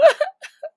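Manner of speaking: high wailing sob; a shrill cry. Acted. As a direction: let out a tearful wail. Crying fit.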